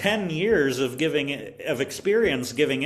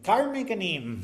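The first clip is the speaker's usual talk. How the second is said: higher-pitched echo of a pupil; imitated young student; 8 semitones higher than usual. Discouraged student answering her teacher, in a higher imitated voice. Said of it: "And she was like".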